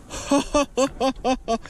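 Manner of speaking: high, soft giggling laugh in a run; giddy laugh. Real, not acted. Angler overwhelmed by the huge fish, giddy laugh. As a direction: let out a high, quiet giggle.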